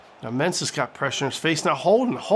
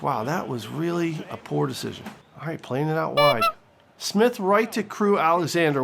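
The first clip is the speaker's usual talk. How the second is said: usual speaking voice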